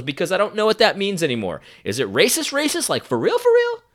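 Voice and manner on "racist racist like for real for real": squeaky, sarcastic rising for real; mock incredulous. Real, not acted. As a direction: scoff at the word with a sky-high sarcastic squeak. Mocking the vague word racist.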